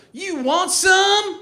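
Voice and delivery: slow, high, loud wrestler taunt; professional wrestler. Acted. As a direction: challenge like a hammed-up pro wrestler. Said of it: "Like a professional wrestler".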